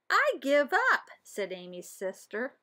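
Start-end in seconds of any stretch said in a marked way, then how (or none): 0.1-1.1 high, drawn-out whine of giving up; a little sister's high sing-song surrender. Acted. Sister gives up on the riddle.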